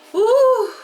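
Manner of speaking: sudden high, loud woo of exasperation; exasperated high whoop. Real, not acted. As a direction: let out a sharp woo. Exclaiming over job stress.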